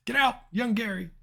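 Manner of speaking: high, loud mock yell at the screen; hammed-up shout. Urging the character in the game to flee, mock shout. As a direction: yell it like a panicked hero.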